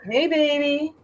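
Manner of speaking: put-on high sweet cat voice calling to her baby.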